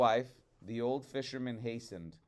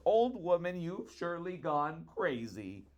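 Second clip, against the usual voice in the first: a touch of fearful pleading, a bit higher; mild terrified plea to his wife; implored in terror; 5 semitones higher, 4 dB louder than usual. A narrator's reading. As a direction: beg her, lightly frightened. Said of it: "Her husband implored her in terror".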